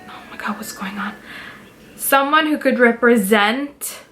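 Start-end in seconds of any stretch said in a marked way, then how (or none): 0.1-1.1 quick unvoiced whisper to herself; whispered aside. Real, not acted. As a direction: whisper it fast under your breath. Distracted aside mid-recording.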